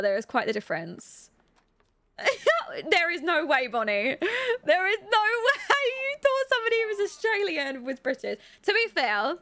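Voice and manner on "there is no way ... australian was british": high, incredulous protest through laughter; real amused incredulity; laughing disbelief. Real, not acted. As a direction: protest in amazement, pitch climbing high. Laughing disbelief at mistaken accent.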